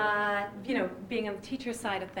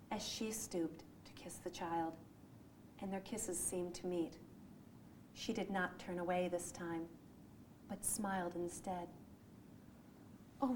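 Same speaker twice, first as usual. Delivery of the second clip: soft, measured recital reading; tender storybook reading, fading softer at the end; story reading; 5 dB quieter, slower than usual. Acted. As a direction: read it gently like a dramatic recital. [name] reads her recital piece.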